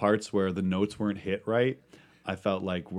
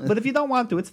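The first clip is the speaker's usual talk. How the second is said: very high, louder squeak; high falsetto voice; 6 dB louder than usual. Acted. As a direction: push it up high. Continuing falsetto.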